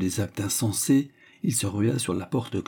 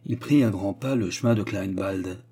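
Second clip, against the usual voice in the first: usual speaking voice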